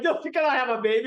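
high, loud, fast comic protest; hammed-up squeak of a man who can't take pain; mock-panicked self. Mock-protesting his own weakness.

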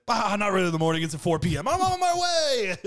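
high, stretched-out hammed-up cry; mock gung-ho holler. Host imitates friend dropping his work excuse, mock gung-ho holler. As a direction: imitate a friend caving and charging over, last words soaring.

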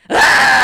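sudden loud startled yelp; retold scream of sudden fright. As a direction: shriek as if someone crept up behind you. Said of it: "I turned around, and I go".

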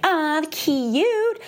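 playful mock aww; mock cooing. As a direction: coo it like seeing a puppy. Mock gushing reaction to challenge.

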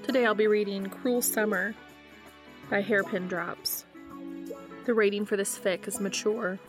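ordinary delivery

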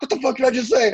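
very high-pitched mock outburst; imitated furious sergeant. First sergeant exploding at the airman, mock furious. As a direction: mimic a superior losing his temper.

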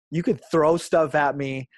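ordinary delivery

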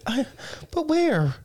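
high, baffled squeak; mock confused recruit. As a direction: play the lost recruit. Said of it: "You'd be like".

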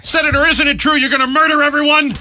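high, loud mock press-pack question; pushy American reporter. Co-host mocking our journalists next to polite Canadians, shrill pushy shout. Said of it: "That's our people".

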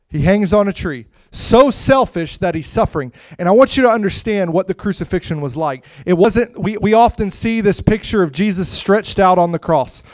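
loud, high, urgent sermon delivery; preacher describing the crucifixion, loud and impassioned; impassioned preaching. Acted. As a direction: paint the cross vividly for them.

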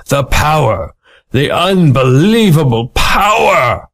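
hammed-up amazement at his own power; awed shout. Squash awed by finger snap.

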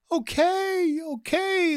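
very high, whiny feminine voice; hammed-up tearful giving in; mock crying frog. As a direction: wail it high, pretending to cry. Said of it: "this time the frog is crying".

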